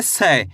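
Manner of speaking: 'से' को खुले 'ऐ' से 'सै' पढ़ें; 'से' यानी 'सै', नीचे गिरते सुर में; सही उच्चारण. Shown as a demonstration. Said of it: "कैसे पढ़ेंगे?". ('से' में 'ऐ' की मात्रा जोड़कर बोलें)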